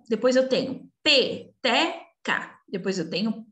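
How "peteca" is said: "peteca" slowly split into pe-te-ca; a clear gap after each syllable of "peteca". Shown as a demonstration. Teacher reading to young pupils, "peteca" slowly syllable by syllable. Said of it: "falar devagarzinho pra vocês tentarem encontrar as sílabas".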